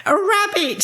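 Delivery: very high exclamation; astonished witch. A narrator's reading. Winnie spots space rabbits, high astonished cry.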